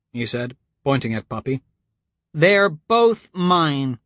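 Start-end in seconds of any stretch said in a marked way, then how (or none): 2.3-4.0 put-on slow, drawn-out, high insisting girl; stubborn little girl insisting. A narrator's reading. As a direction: plant her feet and insist, slowly. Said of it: "she insisted".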